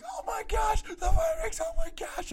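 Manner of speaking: retold excited scream; retold screaming joy. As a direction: relive the screaming celebration. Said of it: "we were screaming on top of our lungs".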